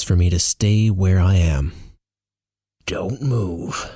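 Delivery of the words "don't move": hushed warning, softer; concerned whisper. A narrator's reading. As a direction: warn softly, keep it under your breath. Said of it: "in a concerned whisper".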